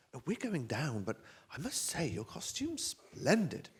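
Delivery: put-on high-pitched genteel old lady; high old-lady compliment; Miss Marple. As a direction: trill it like an elderly detective, slowing at the end. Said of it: "Miss Marple says".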